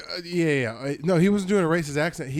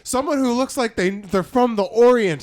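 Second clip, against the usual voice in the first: high, loud, drawn-out mock customer complaint; mock indignant customers; 7 semitones higher, 5 dB louder, slower than usual. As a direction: play an awkward, pointed customer, raised and slow. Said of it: "They're like".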